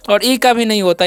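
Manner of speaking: ordinary delivery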